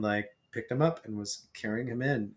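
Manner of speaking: usual speaking voice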